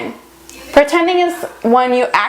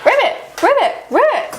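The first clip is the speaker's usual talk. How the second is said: frog croak; 9 semitones higher, faster than usual. Pretend.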